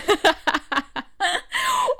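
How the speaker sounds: long, high, loud cackling laughter; big laugh. Real, not acted. Host at joke about the boom, big laugh.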